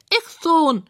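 high squeaky pig voice; high-pitched little pig; squealing pig. A narrator's reading. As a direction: squeal it back proudly. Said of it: "quiekt Rosamunda".

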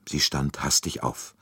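plain reading voice

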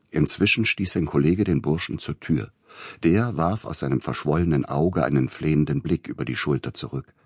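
usual speaking voice

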